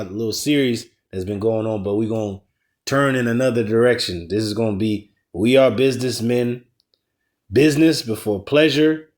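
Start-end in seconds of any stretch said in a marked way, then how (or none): none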